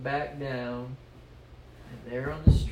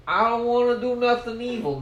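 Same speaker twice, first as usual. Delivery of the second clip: high, loud, quick refusal; hammed-up high-pitched objection; whiny protest; 10 semitones higher, 10 dB louder, faster than usual. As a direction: protest to the demon in a raised, hurried voice. Stoogie protests to the demon.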